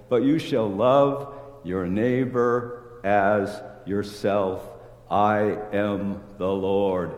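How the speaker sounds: slow, weighty stress on every word of love your neighbor as yourself; emphasized slowly. Shown as a demonstration. Preacher stressing the scripture to the congregation, slow and weighty. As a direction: lean hard on each word, drawn out. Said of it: "Emphasis, emphasis, emphasis".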